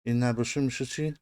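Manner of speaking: usual speaking voice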